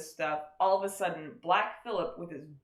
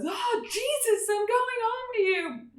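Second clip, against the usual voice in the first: hammed-up ecstatic deathbed speech; mock rapturous dying boy; 5 dB louder than usual. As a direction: swoon it out like a holy martyr. Dying boy's religious speech.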